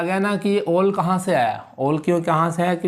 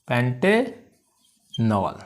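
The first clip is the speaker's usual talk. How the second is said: Pentanol very slow, पेंटे held, pause, then नॉल; syllable by syllable; 3 semitones lower, much slower than usual. Shown as a demonstration. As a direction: stretch out pentanol in two separate parts. Teacher repeats it in parts.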